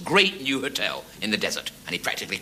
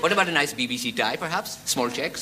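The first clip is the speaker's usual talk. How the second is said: put-on Pakistani accent; 3 semitones higher than usual. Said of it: "speaks with a Pakistani accent".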